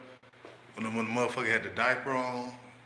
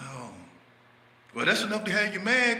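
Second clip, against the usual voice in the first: higher put-on voice, egging him on; goading friend; 11 semitones higher than usual. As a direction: dare him to swing, pitched up.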